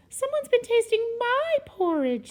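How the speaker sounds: high, loud Mama Bear; middle-sized bear voice. A narrator's reading. Mama Bear finds her porridge tasted, high middle-sized voice. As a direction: pitch it well up, indignant bear mother. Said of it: "said Mama Bear in her middle-sized voice".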